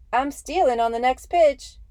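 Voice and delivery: loud, high squeaky shout. Acted. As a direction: yell the taunt in a squeaky voice. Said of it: "he shouted at Rowe in that squeaky voice of his".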